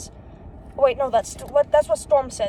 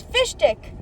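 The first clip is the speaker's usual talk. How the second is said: raised-pitch exclamation on finding someone; exclaiming a name; 6 semitones higher than usual. A narrator's reading. Scientist exclaims on finding Fish Stick.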